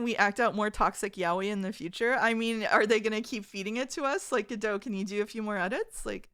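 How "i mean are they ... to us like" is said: ordinary delivery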